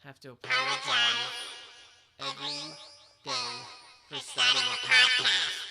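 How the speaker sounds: squeaky voice-changer chipmunk; voice changer demo. Shown as a demonstration. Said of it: "talk into this little voice changer".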